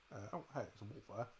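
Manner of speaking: normal talking voice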